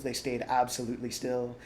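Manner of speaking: usual speaking voice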